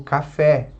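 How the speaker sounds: café with a strong final "fé"; stress on last syllable. Shown as a demonstration. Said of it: "sílaba forte é a última". (café com a última sílaba forte)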